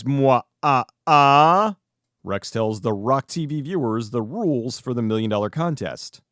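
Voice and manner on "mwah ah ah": evil laugh. Pretend. Butler's menace, villain laugh.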